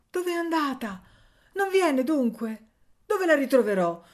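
high, loud, desperate questions; despairing. A narrator's reading. Abandoned little boy asking for his mother, despairing. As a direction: plead like a lost child. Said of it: "si domandava con disperazione il piccolo abbandonato, piangendo sconsolatamente".

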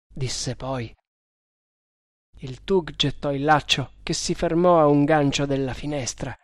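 ordinary delivery, nothing marked

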